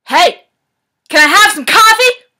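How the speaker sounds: high, very loud scream; all-out bellow. Shown as a demonstration. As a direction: bellow it as loud as you possibly can. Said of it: "say it as loud as you possibly can. Bellow".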